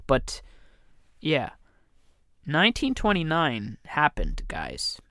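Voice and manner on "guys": whisper. Real, not acted. Aside to listeners about 1929.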